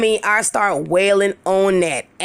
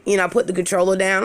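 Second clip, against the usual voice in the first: ordinary delivery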